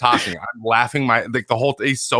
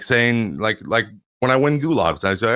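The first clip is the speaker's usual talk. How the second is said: normal talking voice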